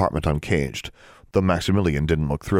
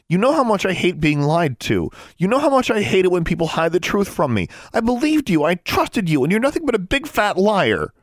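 high, heated outburst; angry accusation; 13 semitones higher, 4 dB louder than usual. A narrator's reading. Sister accusing brother of lying, angry outburst. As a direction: let fly, pitched way up.

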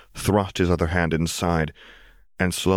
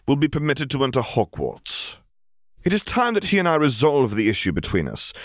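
higher, measured decree; commanding dark lord; 6 semitones higher than usual. Acted. Riddle ordering Lucius to carry his message, cold command. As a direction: give orders with cold authority.

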